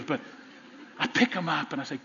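ordinary talk

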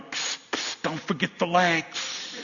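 playful whispered reminder from above; stage whisper. Acted. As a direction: friends on the roof prompting in a sneaky hush. Friends on roof prompting Jesus.

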